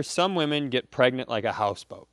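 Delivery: ordinary delivery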